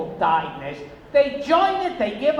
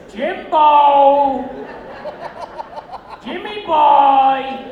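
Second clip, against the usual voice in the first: much louder, slow, stretched-out "Jimbo"; drawn-out coaxing call; 5 semitones higher, 8 dB louder, much slower than usual. Retold or imitated. Teasing his friend Jim Jones on the beach, loud and drawn-out. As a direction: jokingly sweet-talk him out of it.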